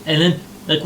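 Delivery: ordinary talk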